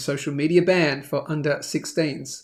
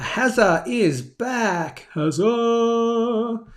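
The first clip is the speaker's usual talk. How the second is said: slow, drawn-out, higher welcome cry; playful fanfare; 6 semitones higher, much slower than usual. Pretend. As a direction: stretch the name out like a cheer. Hailing a returning regular viewer.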